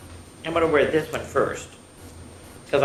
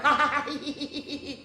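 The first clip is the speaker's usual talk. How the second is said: high giggles trailing softer; helpless giggling; 11 semitones higher, 3 dB quieter than usual. A narrator's reading. Bismarck lost to laughter at underwear, helpless giggling. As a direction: giggle until it peters out.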